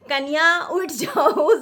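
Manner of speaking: slow, sweet sing-song wake-up call; loving call. Retold or imitated. Mother-in-law sweetly calling the sleeping bride at three. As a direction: coax gently from downstairs. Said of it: "नीचे से आवाज़ देती थी".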